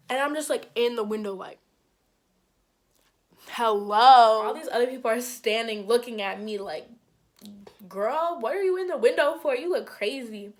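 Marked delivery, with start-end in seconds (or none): 3.5-4.4 loud mock hello through glass; calling out. To the couple inside, a loud questioning hello. 7.9-10.5 higher, louder, fast teasing question; mocking onlookers. Onlookers teasing the girl in the window, higher and faster.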